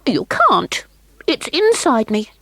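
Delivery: put-on a cold child refusing; little girl. Child Queenie voiced.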